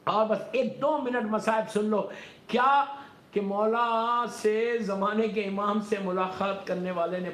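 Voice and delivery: high, forceful sermon delivery; emphatic high-pitched preacher; impassioned preaching. Real, not acted.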